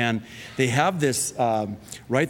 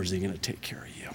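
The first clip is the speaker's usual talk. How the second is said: whispered, soft and quick; sudden whisper after the joke; hushed whisper; 6 semitones lower, 10 dB quieter, much faster than usual. Real, not acted. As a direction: drop to a whisper to drive it home. Sermon point after comic bit.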